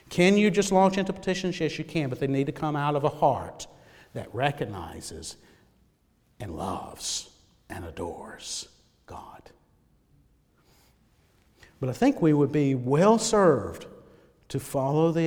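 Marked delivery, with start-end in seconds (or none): 4.1-9.4 low, soft, slow into a whisper; quietly adoring, fading out; reverent whisper. Real, not acted.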